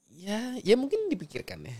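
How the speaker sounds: normal talking voice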